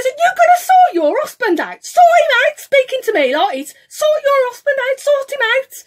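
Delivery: loud, high, insistent scolding; retold angry demand. Telling the driver's wife to sort her husband out, angry. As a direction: re-enact yelling through a car window. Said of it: "I was so angry".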